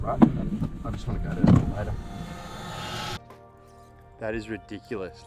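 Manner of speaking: normal talking voice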